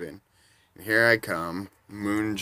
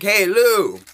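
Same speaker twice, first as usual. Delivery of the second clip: loud high friendly call; 13 semitones higher, 13 dB louder than usual. Retold or imitated. Fan calling out to Lou at his table, loud and high. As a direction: shout a cheery hello across the table.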